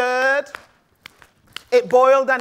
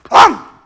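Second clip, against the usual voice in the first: loud gulp; 2 semitones higher, 9 dB louder than usual. Acted. Cat gulps the lady.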